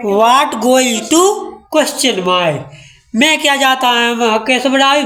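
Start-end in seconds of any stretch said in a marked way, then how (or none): 0.1-2.6 every word slow and spaced apart; slow dictation. Shown as a demonstration. Teacher dictating the English to her class, slow. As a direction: dictate it word by word so they can write. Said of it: "तो ये में कहा है".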